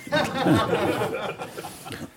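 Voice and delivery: a real, high burst of laughter; short high laugh. Real, not acted. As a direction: laugh out at the punchline. Laughs at own joke.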